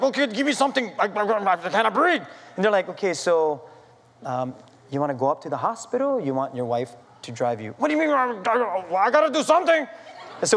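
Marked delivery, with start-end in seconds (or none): 0.0-2.2 acted panic. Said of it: "I'm here panicking". 2.9-7.7 imitating calm medics. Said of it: "you guys are so calm". 7.8-9.9 high, frantic, stammering retort; hammed-up panic at the medic's calm question; acted panic.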